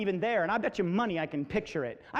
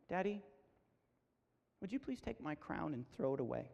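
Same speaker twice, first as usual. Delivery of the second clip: small, soft, lowered voice; hurt child; 5 semitones lower, 12 dB quieter than usual. Retold or imitated. Daughter asking dad to toss her crown, hurt. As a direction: imitate a wounded child asking very quietly.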